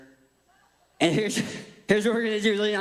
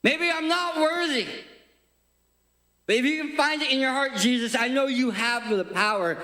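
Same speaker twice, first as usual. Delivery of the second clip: high-pitched anguished appeal; desperate plea; 7 semitones higher than usual. Acted. Leper pleading with Jesus, high desperate cry. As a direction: cry out, begging to be found worthy.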